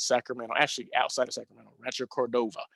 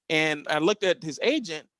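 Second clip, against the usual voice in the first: ordinary delivery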